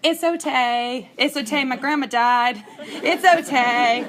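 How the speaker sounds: laughing daughter imitation. Little daughter soothing her sobbing mother on the floor, imitated laughingly. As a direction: mimic a child consoling, giggling as you go.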